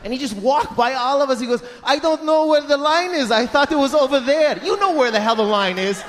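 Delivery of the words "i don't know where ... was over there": higher-pitched helpless excuse; feigned confusion. Acted. Dad excusing queue jumping, feigned confusion. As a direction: plead innocence, acting lost. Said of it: "they always act confused".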